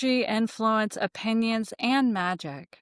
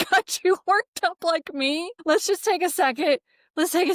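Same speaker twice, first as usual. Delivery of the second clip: laughing; 8 semitones higher than usual. Real, not acted. Laughs after her own rant.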